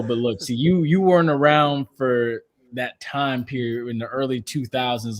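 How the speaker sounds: normal talking voice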